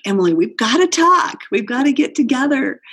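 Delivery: playfully imitated excitement; excited. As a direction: mimic a friend's excited call. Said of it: "he got so excited".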